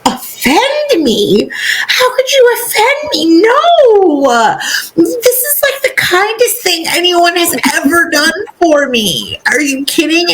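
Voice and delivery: high, tearful mock Dobby voice; high-pitched tearful elf imitation; weepy Dobby. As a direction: squeak it out as an overwhelmed little elf. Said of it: "He's like".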